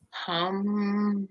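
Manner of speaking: long, soft, low hum; soft drawn-out meditation om; meditative hum. Pretend. Picturing meditation under the grapevines, long soft hum. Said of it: "And just be like".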